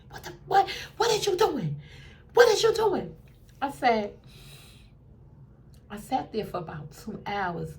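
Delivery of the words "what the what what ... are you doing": high, startled what are you doing; pulled into the hallway, alarmed; startled protest. Retold or imitated. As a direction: yelp it as you're yanked away. Startled as he drags her out.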